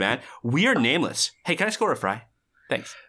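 movie character monologue. Acted. Interrupting character declaring namelessness, then asking for a fry, animated. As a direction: act out the remembered movie lines with exact inflection. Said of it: "Your inflection is exact".

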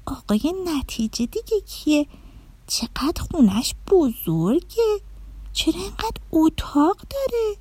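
put-on high, soft wondering child; astonished questioning. A narrator's reading. Dorna asks the fairy about the huge house, astonished. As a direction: ask in rising, high-pitched amazement. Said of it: "با تعجب پرسید".

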